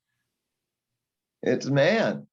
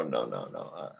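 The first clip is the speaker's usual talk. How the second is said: weak and faint; 13 semitones lower, 7 dB quieter than usual. Acted. Weak uncle after fainting spell.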